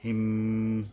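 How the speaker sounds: "হিম" with a long hummed m; nasal hum closing "হিম"; ghunnah. Shown as a demonstration. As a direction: hold the m of "হিম" through the nose. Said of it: "মিমের উপরে গুণনা করতে হবে".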